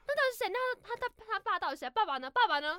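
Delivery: high, eager, rapid questions; excited questioning. Retold or imitated. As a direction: pester for the answer, pitch up. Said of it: "然后就说". (兴奋地追问)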